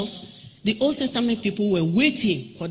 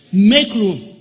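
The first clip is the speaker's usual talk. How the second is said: loud exhortation; 8 dB louder than usual. Real, not acted. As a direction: Make room, shouted with force. Preacher exhorting congregation.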